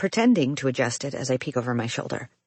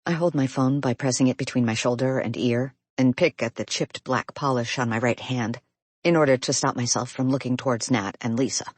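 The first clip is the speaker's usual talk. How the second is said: usual speaking voice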